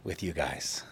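calmly telling the management office he is frustrated. Retold or imitated. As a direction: say it evenly, deliberately calm. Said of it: "very calmly said".

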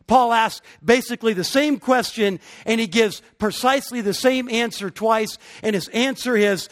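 rising, emphatic sermon delivery; preaching. Acted. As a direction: preach it, building with conviction. Preacher builds to full force.

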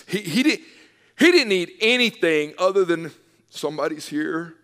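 preaching at full force. Real, not acted. Pastor insisting to the church that presence matters, loud. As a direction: build it into a shout.

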